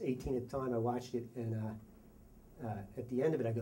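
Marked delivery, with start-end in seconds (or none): none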